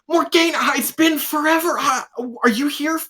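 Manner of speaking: very high, rushed, overjoyed voice; Zolt's excited greeting. Acted. Zolt hugging Morgaine after years apart, very high and excited. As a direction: squeal it out like an excited little friend. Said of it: "He's, he's like".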